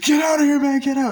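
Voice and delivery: high, loud screamed get out; high loud panicked shout; retold shout. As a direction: yell him out of the room. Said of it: "and he goes".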